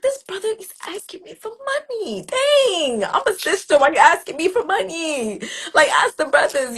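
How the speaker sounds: high, loud, huffy indignation; indignant. Retold or imitated. Her old self at the train, indignant and annoyed. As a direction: play her old hard self, offended. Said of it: "I was like".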